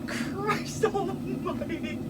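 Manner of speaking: high, slow, breathless from laughing; weak, high voice after hard laughter; worn out laughing. Real, not acted. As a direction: squeak it out at the end of a laughing fit. Recovering from a laughing fit.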